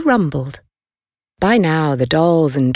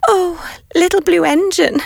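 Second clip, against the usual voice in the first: high, pleading little toy voices; 12 semitones higher than usual. A narrator's reading. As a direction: beg the big engine, high and eager. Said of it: "cried the dolls and toys".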